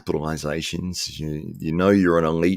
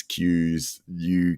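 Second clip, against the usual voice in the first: normal talking voice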